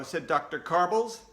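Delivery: plain reading voice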